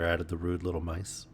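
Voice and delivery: plain reading voice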